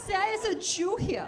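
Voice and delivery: put-on high-pitched German accent; high, campy German voice. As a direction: announce it in a camp German accent.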